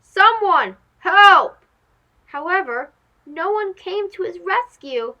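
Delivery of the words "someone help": loud desperate shouts for help; calling for help. Acted. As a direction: yell for rescue from the treetop. Monkey calling for rescue.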